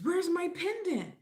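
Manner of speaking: high, huffy customer voice; mock indignant customer. Customer voiced in retold meme.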